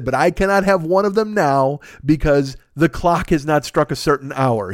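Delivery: slow, spelled-out objection; measured, pointed disbelief; retold incredulous recap. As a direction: lay out the absurd rule word by word.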